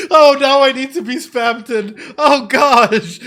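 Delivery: shocked laughing shout; cracking up in disbelief. Real, not acted. Streamer shocked by clip.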